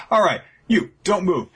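high, snotty order to freeze; bratty prince pointing at a servant; spoiled brother menacing the chef, high snotty voice; petulant threat. Retold or imitated.

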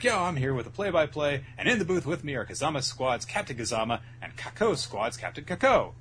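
lightly voiced match commentator; play-by-play commentator. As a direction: announce the booth guests with rising energy. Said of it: "is there on commentary".

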